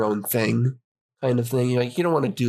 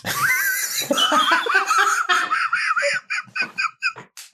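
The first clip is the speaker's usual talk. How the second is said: shrieking laugh. Real, not acted. Laughs after creepy joke.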